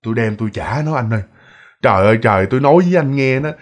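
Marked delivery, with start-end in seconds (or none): 0.1-1.2 subdued quote. Retold or imitated. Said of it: "Ông Niệm nói".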